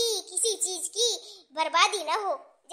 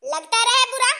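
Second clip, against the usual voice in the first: loud, higher dismissive snap; scornful mother-in-law; callous retort; 4 semitones higher, 6 dB louder than usual. Acted. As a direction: throw it back angrily. Mother-in-law dismisses hurt feelings.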